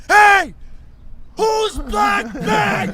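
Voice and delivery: high, loud, drawn-out yell across the hall; airport guard shout. Retold or imitated. Airport security guard shouting at the crowd about an abandoned bag, loud shout. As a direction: bellow it like security spotted a bag. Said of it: "this guy goes".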